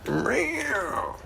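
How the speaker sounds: 2 high, ragged cat meow; 3 meow like an old smoker cat; 1 imitated raspy meow. 4 owner imitating her old cat's ragged meow, pitched high. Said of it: "raggedy old cancer-stricken sounding voice".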